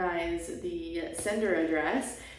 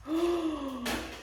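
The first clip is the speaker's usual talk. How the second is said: quiet surprised gasp; soft gasp; 6 semitones higher, 7 dB quieter than usual. Real, not acted. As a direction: gasp softly in awe. Seeing the knife.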